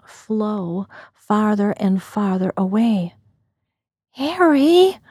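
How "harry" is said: higher-pitched cry of a name in shock; wounded, rising call; shocked, hurt call. A narrator's reading. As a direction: call out, stung and startled. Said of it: "I called with shock and hurt in my voice".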